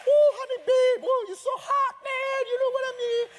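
high, loud hammed-up gushing at his wife; mock doting husband. Husband mock-gushing over his wife, loud and high. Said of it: "just stay plugged in".

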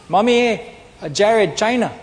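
put-on high, loud little boy voice; a toddler announcing his wish; loud, high "Mommy, Jared, China"; child's voice.

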